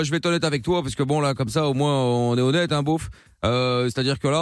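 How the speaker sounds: put-on accent du Sud, voix plus grave; southern French accent. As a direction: play a cocky guy from the Sud with a thick southern French accent. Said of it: "avec ton accent, là, à la con".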